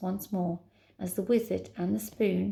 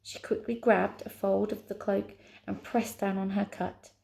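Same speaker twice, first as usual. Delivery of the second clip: plain reading voice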